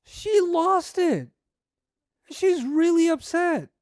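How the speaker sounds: high, loud, drawn-out exclamations; worked-up outburst. Retold or imitated. Preacher telling how the wife lost it, worked-up. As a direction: stretch each phrase, high and loud. Preacher mimics the wife's hysteria.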